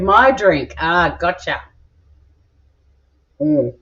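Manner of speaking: ordinary talk, nothing marked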